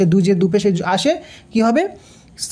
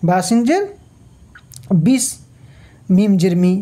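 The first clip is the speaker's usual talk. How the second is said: usual speaking voice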